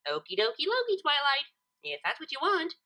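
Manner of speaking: breezy and bouncy; bubbly pony happily agreeing; cheery Pinkie. A narrator's reading.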